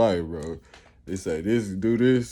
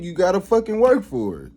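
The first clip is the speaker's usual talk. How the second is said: ordinary talk, nothing marked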